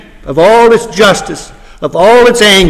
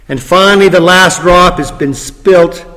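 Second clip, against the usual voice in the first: ordinary delivery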